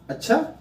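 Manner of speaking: अच्छा rising high at the end; sudden question. Shown as a demonstration. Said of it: "यहाँ पे प्रश्नात्मक point आ जा रही है".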